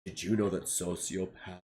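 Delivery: low, deep creepy man voice; creepy boss. Retold or imitated. Retelling the creepy boss, low and deep. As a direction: drop way down like a creep. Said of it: "he's like".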